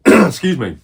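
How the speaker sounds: normal talking voice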